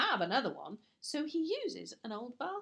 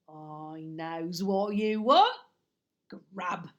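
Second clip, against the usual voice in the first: slow, lower, loud gruff burglar; Burglar Bill voice; 4 semitones lower, 9 dB louder, slower than usual. Acted. Burglar offering the baby an apple, gruff and slow. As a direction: offer it like a rough old burglar.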